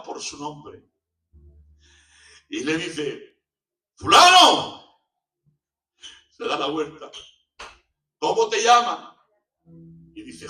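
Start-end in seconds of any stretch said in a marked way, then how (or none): 3.9-5.0 slow, loud high call across the square. Acted. King calling the young man back, loud slow call. 8.2-9.2 high, loud royal demand; loud question. Acted. King asking the young man's name, loud.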